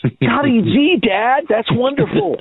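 put-on high, gushing wholesome kid voice; wholesome 1950s kid. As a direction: gush like a clean-cut 1950s boy. Mock kid cheering dad's Facebook page.

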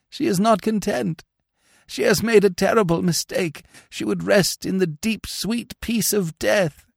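high, pleading, tearful; a touch of weeping, slowing on sweet peace; tearful lament. A narrator's reading. Priest grieves that the Countess is not at rest, high and tearful. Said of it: "he sobbed".

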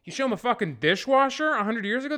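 normal talking voice

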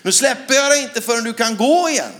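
retold shout. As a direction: shout the vow while holding her up. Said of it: "så skrek jag i micken".

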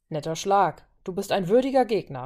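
usual speaking voice

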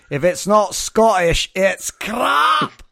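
hammed-up Scottish cry, loud and high; mock Scottish accent. Host introducing a Glaswegian friend with a catchphrase, mock Scottish shout. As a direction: belt it out in an exaggerated Scottish accent, drawn out.

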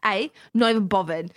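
put-on cheeky Cockney schoolkid accent, "bovvered"; bratty Cockney. Pupil brushing off the teacher, bratty Cockney accent. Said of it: "absolutely bratty Cockney English".

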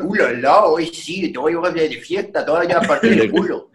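hammed-up Spain Spanish accent, lightly done; mock a Spaniard's Spain Spanish accent playfully; fake Spain Spanish accent. Friend imitating a party-loving Spaniard, fake Spain Spanish accent. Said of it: "Es mi mejor imitación de un español".

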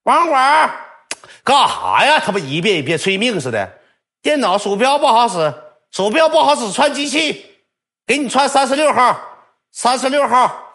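high, loud shout across the room; mock shouting for the net cafe attendant; calling out. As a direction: yell for the attendant downstairs. Repeated calling to admin.

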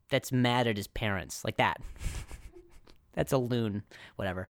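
normal talking voice

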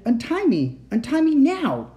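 high, loud demand; shouting. A narrator's reading. Child tied to a chair orders uncle, shouting. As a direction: shout it urgently, pitch climbing. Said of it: "I shouted".

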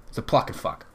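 usual speaking voice

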